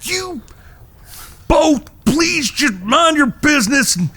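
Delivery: shouting the brothers back to their chores; exasperated shout. Acted. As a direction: order them off, nearly begging. Third angry outburst at brothers.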